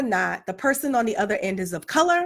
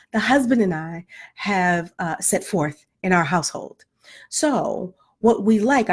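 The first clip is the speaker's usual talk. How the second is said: Claire Huxtable voice; 6 semitones lower, slower than usual. Retold or imitated. Mom calling the school office, in a lightly polished proper voice. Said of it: "in my Claire Huxtable voice".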